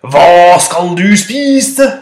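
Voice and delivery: slow thundering roar; roaring goat. Acted. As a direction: bellow like a huge billy goat. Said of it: "brølte den store bukken". (langsomt tordnende brøl)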